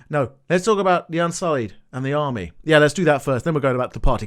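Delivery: ordinary talk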